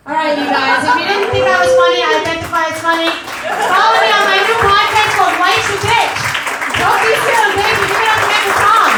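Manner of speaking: loud showbiz goodbye; big sign-off. Real, not acted. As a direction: wrap up the set at full volume.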